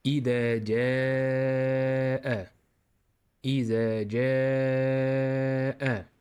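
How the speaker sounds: slow, chanted Quranic recitation. Shown as a demonstration. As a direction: recite it in tajweed style. Teacher recites Quran model.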